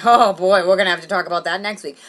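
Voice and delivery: put-on loud, heavy "oh boy"; imagined dismayed therapist. Comic imagining therapist's alarm, dismayed. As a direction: hit the opening hard, as if dreading it. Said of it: "or did she say, like".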